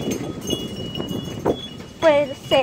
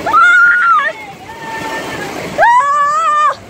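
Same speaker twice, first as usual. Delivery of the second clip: high scream; 12 semitones higher than usual. Real, not acted. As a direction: scream out in shock. Screaming at the tornado.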